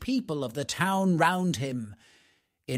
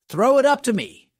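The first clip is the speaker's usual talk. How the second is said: higher, louder, quick call; 8 semitones higher, 7 dB louder, faster than usual. A narrator's reading. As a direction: shout it up to the boy below. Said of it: "called Hans".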